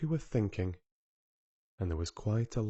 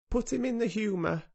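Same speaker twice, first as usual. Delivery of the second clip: much higher, mock doctor's temper; 12 semitones higher than usual. A narrator's reading. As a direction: quote it with an irritated edge. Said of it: "as if he was in a temper".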